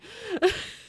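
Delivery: short high-pitched laugh; a quick high giggle; laughing at herself. Real, not acted. Laughing at own accent story.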